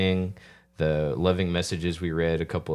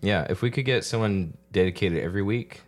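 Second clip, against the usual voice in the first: ordinary talk, nothing marked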